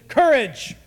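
one loud, punched word; proud billionaire declaring it; firm declaration. Retold or imitated. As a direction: bark the answer proudly. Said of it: "And he said".

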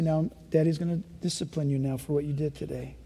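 soft, low and hushed, as a father entering the room. Retold or imitated. Father softly telling his child the discipline is coming, hushed. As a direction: speak it down low like a gentle but firm dad.

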